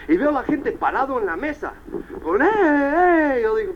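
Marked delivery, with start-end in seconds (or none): 2.4-3.4 long high-pitched mock whoop; imitated drawn-out party holler; imitated crowd cheer. People standing on the table, imitated high cheering.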